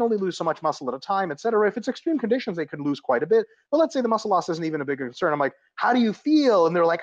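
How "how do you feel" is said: acted coach question. Acted exchange with a dieting client.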